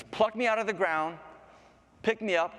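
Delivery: high, urging flower voice; talking flower. Acted. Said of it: "He goes".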